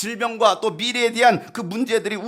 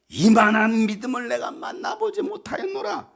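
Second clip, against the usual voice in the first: high-pitched proclamation of admiration; amazed praise; 5 semitones higher than usual. Acted. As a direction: marvel aloud, ringing it out. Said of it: "이런 칭찬받는 여러분". (감탄하며 높게 칭찬)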